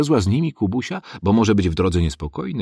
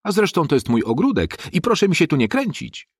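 high indignant telling-off; mother berating boys with sticks; scolding; 11 semitones higher than usual. A narrator's reading. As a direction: tear into the crowd.

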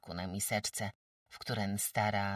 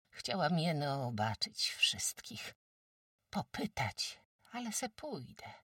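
fearful, apologetic; 5 semitones higher than usual. A narrator's reading. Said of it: "lękliwie przepraszająco ozwała".

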